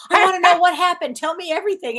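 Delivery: high, excited retelling, loud at first; eager demand. Retold or imitated. Daughter questioning her dead father over there, eagerly demanding. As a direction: press eagerly for the whole story, acted out.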